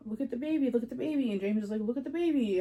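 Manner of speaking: lightly voiced family coaxing her awake; urging mom voice.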